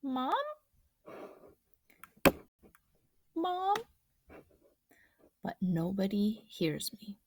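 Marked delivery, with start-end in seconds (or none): 0.0-3.8 1 calling out uncertainly. Acted. Boy calls tentatively for Mom.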